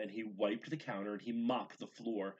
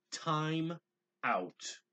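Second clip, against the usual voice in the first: firm, raised mother's order; 8 semitones higher, 5 dB louder than usual. A narrator's reading. Mother ordering Gabriel to time out, firm loud command. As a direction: stop the child with a sharp command. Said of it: "ordered Gabriel's mother".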